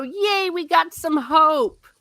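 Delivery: high, drawn-out little hooray; cheering. Real, not acted. Reader glad the group has hope, cheering. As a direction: cheer it high, stretching the end. Cheering the hopeful chapter ending.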